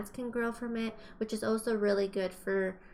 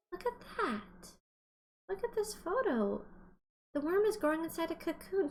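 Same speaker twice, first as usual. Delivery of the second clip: amazed look; 7 semitones higher, 3 dB quieter than usual. Acted. Teacher marvels at worm photo.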